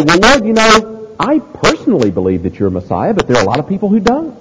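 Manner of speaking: hammed-up wishy-washy believer; mock noncommittal disciple. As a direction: hedge it, rushing the last clause upward. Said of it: "Nor does he say".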